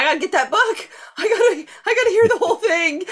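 laughing excitedly, pitch jumping high; breathless giddy laugh through the words; eager laughing rush. Real, not acted. Guest overcome, laughing about book.

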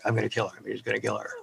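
low, very quiet mock threat; retold threat, dropped low and soft; muttered threat. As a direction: mutter it under your breath, as an exasperated mother. Mother's retold exasperated threat.

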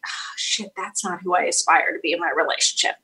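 under the breath, unvoiced then soft; muttered regret after a fight; regretful mutter. Self-reproach quote after an argument.

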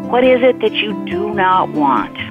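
ordinary delivery

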